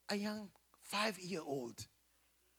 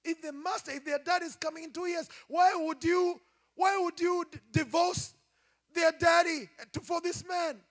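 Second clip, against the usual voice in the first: higher, louder, pleading; pleading shout; 7 semitones higher, 6 dB louder than usual. Retold or imitated. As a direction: beg her not to do it, rising to a cry. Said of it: "taking to her and tell her".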